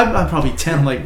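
ordinary delivery

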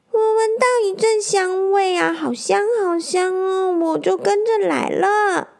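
put-on high childlike voice, delighted by the smell; a little boy gushing about yummy food; squeaky-high hedgehog, excited; eager hedgehog kid. Hedgehog answers.

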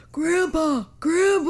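a lightly voiced old man calling Grandpa. Croaky old man calling, lightly done. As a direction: owner calls the cat Grandpa in a mild old-man voice. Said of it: "so you actually sound like an old man".

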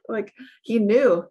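normal talking voice

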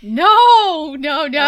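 loud, high drawn-out no; shocked protest. Real, not acted. Host objects to co-host's tasteless dingo quote, loud protest. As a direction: cut the joke off loudly.

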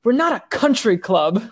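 mild mock yell. Podcaster quotes M yelling at a quitting Bond, mildly mock yelling. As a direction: lightly imitate a boss telling someone off. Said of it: "M yells at him".

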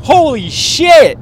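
high, loud, drawn-out whoas of alarm; shocked. Retold or imitated. Friend reenacting seeing the hickey, high and shocked. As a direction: act stunned at the sight of a huge hickey. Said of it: "I was like".

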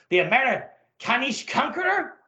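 put-on gruff German officer, German accent; comic-book Nazi colonel, German accent. Colonel speaks.

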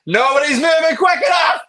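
high, loud drill-style yell; acted coach's bellow; coach's shout. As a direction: shout it at a slacking class. Acts out coach's bad-day yell.

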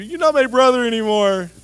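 put-on high, loud kid voice; childish sibling spat. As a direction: shout it like a sulky little kid.

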